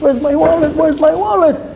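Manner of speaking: frantic, high-pitched repeated demand; panicking, pitch jumping up; losing it. Acted. Friend finds his wallet gone, losing it in panic. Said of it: "like, losing it".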